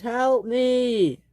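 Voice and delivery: high acted cry for help; high-pitched distress call; screamed plea. A narrator's reading. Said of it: "he screamed".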